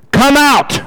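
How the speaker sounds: high, ringing summons on two words; Jesus calling out. Acted. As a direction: call someone out of the grave, pitched high. Acting Jesus calling the dead out.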